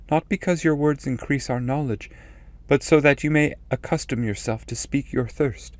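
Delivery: a gentle hint of Beatrice urging him on; Beatrice's voice. A narrator's reading. Beatrice speaks to the narrator.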